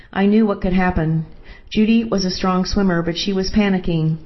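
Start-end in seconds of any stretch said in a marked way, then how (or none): none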